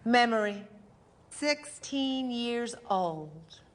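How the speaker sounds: slow, measured title read; stage heading, slow and even; announcer heading. Acted. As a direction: announce the memory slowly. Memory heading.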